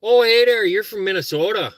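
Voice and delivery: hammed-up Minnesota hello; Minnesota accent. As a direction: play the chirpy Minnesotan. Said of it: "I know I have an accent".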